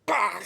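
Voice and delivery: loud straining grunt; effort grunt. Real, not acted. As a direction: grunt hard as you lift. Lifting effort.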